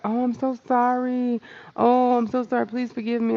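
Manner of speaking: lightly voiced embarrassed customer; apologetic caller. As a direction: gush out a mock apology. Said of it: "They're like".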